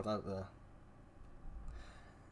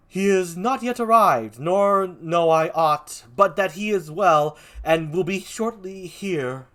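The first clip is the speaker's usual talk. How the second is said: louder, declaimed stage reply; theatrical messenger; 5 semitones higher, 6 dB louder, much slower than usual. Acted. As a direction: report it grandly, stretching the key words. Answers in Cassio's part.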